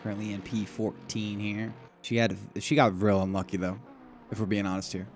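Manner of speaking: ordinary talk, nothing marked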